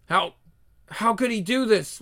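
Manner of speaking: ordinary talk, nothing marked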